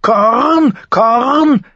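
very high squawked caw; screeching raven. A narrator's reading. Raven cries out over the fight, screeching caw. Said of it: "kreischte der Rabe".

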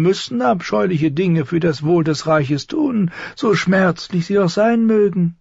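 smooth and falsely sympathetic, like a sly courtier; oily eunuch. Acted. Varys speaks to Ned. (salbungsvoller Höfling, deutlich höher)

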